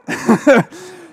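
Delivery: high, loud laugh breaking out; can't hold back the laugh; real amused laughter; burst of laughter. Real, not acted. Laughing again at her reply.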